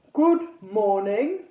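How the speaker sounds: slow, booming suit-of-armour voice; booming knight ghost. A narrator's reading. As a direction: boom it out slowly from inside the armour. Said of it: "booming voice that came from somewhere inside".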